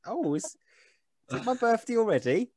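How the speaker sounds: put-on high, slow, lightly croaky old lady. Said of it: "she goes".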